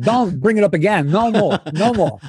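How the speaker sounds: a touch of an annoyed Walken; exasperated Walken. Retold or imitated. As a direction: shut the idea down flatly, Walken-like. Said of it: "And finally he said".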